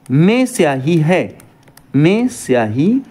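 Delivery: slow, with में drawn out and leaned on; stress on में. Shown as a demonstration. Teacher shows students the postposition, में slowly stressed. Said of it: "यहाँ पर में का प्रयोग किया गया है".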